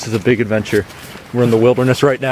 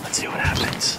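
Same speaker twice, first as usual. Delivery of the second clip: hushed, unvoiced whisper; 10 dB quieter than usual. Real, not acted. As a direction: whisper it like sneaking up. Sneaking toward gym guys.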